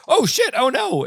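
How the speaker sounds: high-pitched mock panic; sudden startled yelp, acted out; retold alarm. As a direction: react as if the alert is brand new. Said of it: "the first time you see it".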